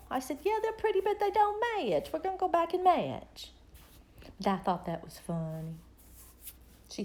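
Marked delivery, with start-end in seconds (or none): 0.4-3.5 high-pitched retold motherly reply; retold mom putting her foot down; mom insisting. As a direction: overrule the child, voice well up. Retold mom answering her girl.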